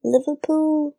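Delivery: high-pitched, confident Scouse Liverpool accent; good Liverpool accent. Shown as a demonstration. Cohost retries to prove herself, convincing Liverpool accent. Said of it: "that was good".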